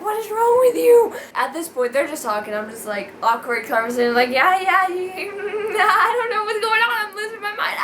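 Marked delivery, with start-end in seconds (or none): 0.0-1.1 high, loud exasperated cry. Retold or imitated. Exasperated at hugging. 4.3-7.8 high, giggly flustered babble; flustered laughing. Retold or imitated. Flustered awkward conversation.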